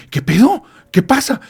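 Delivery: jumpy, rattled, what's going on; nervous alarm. Retold or imitated. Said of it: "se pone con los nervios de punta".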